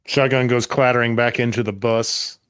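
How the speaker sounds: usual speaking voice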